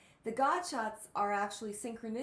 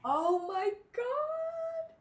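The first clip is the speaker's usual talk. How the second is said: high, loud, drawn-out amazement; astonished; 7 dB louder, much slower than usual. Real, not acted. Listener stunned by friend's sermon coincidence, astonished. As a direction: stretch it out in real astonishment, pitch soaring.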